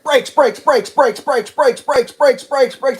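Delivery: panicked repeated yell; yelling brakes. Retold or imitated. Driver yelling at himself to brake. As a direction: shout it, urgent and high.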